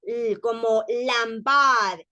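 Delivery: slow LAMBAR, sliding through the L; gliding L. Shown as a demonstration. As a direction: draw out "lambar" smoothly. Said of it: "así como que vamos en deslizadero". ("lambar" deslizando la L)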